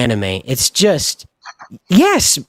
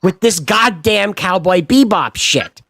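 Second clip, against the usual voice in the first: ordinary delivery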